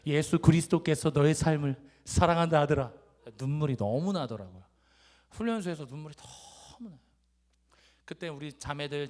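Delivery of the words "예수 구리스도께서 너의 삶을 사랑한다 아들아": loud, proclaiming letter readout with 그리스도께서 as 구리스도께서; loud, deliberate reading of a father's letter; emphatic letter reading. Retold or imitated. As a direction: declare the father's message at full voice. Reads father's heartfelt letter, misspelling kept.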